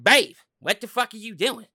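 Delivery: mock wife yelling at her man; annoyed wife. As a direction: snap at him like a fed-up girlfriend. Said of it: "she be like".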